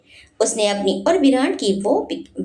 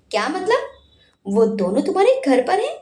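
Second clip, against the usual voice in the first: higher, quick puzzled question; surprised; 6 semitones higher, faster than usual. A narrator's reading. Virat asks if they are at her house, surprised. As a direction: ask in wonder. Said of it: "Virat ने आश्चर्य से पूछा".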